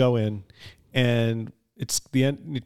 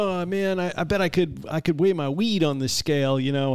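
1 Bronx tough guy; 4 semitones higher than usual. Retold or imitated. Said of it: "the guy's like".

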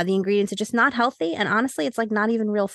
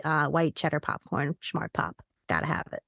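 hushed confession; 6 semitones lower, 6 dB quieter than usual. Real, not acted. Confessing favourite junk snack.

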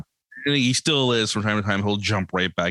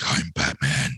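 low gravelly-free deep Batman growl, pitched lower; 7 semitones lower than usual. Retold or imitated. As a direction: imitate a kid doing a deep Batman voice. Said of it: "he's doing the whole voice".

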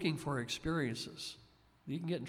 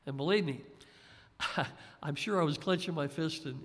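usual speaking voice

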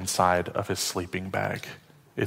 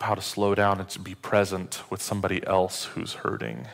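ordinary delivery

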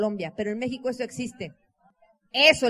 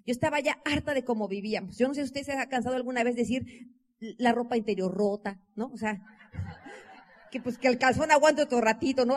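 normal talking voice

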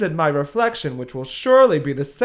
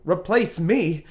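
ordinary talk

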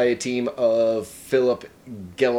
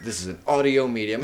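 normal talking voice

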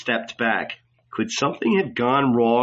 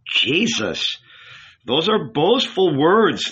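raised, reproachful rabbi; objecting old rabbi; 6 semitones higher, 3 dB louder, slower than usual. A narrator's reading. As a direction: scold him as the old rabbi. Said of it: "the rabbi objected".

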